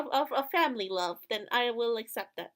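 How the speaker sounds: ordinary delivery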